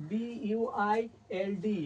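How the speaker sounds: বিইউআইএলডি spelled out very slowly, letter by letter; teacher spelling slowly for the class. Shown as a demonstration. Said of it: "এখানে দেখো UI আছে".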